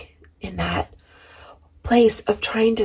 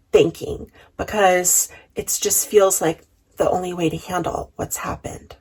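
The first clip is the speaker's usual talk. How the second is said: ordinary talk, nothing marked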